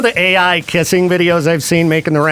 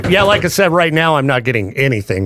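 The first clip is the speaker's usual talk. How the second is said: normal talking voice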